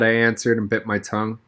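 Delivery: usual speaking voice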